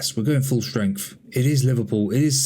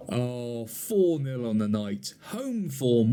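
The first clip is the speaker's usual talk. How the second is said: ordinary talk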